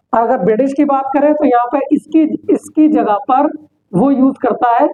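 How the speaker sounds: usual speaking voice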